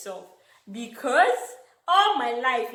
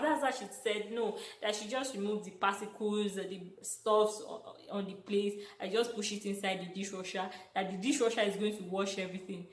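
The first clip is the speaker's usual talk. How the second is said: ordinary talk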